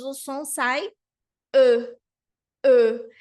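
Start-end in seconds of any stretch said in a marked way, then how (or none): 1.5-3.1 "E" with rounded lips, loud, the French ø. Shown as a demonstration. Teacher demonstrating French ø, loud and rounded. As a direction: push out "E" through rounded lips. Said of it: "quando você fala E com os lábios arredondados".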